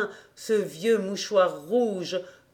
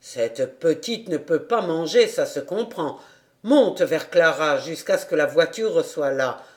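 lower, gentle fatherly voice; kindly; 4 semitones lower than usual. A narrator's reading. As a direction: speak kindly to the child. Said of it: "ajouta-t-il avec bonté". (avec bonté, voix grave et douce)

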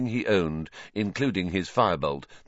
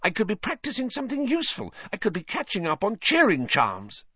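higher, sharp Hermione whisper-hiss; 13 semitones higher than usual. A narrator's reading. Hermione hissing to Harry, impatient and sharp. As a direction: hiss it impatiently under breath.

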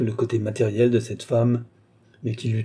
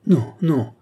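louder, higher, emphatic refusal; crying out; 5 semitones higher, 5 dB louder than usual. A narrator's reading. Lorenza protests to Balsamo's promise of freedom, crying out. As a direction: cry out a protest. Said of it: "s'écria Lorenza".

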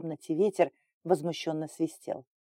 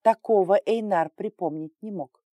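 usual speaking voice

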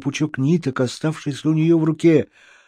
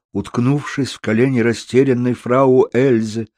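ordinary delivery

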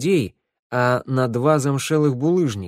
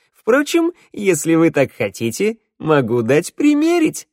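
cheeky; 6 semitones higher, 5 dB louder than usual. Acted. Kobzar cheekily offers.

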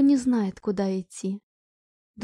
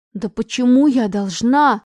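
slow, loud, near tears; almost crying; 7 dB louder than usual. A narrator's reading. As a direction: plead on the edge of tears. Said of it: "чуть ли не плача спросила Аня".

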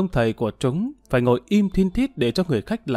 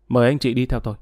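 ordinary delivery

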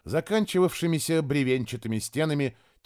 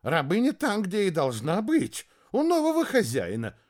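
hasty placating; 7 semitones higher than usual. Acted. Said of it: "поспешил снять напряжённость Иаков".

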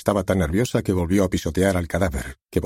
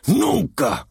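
anguished cry; 12 semitones higher than usual. Acted. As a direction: burst out in anguish. Despair bursts out.

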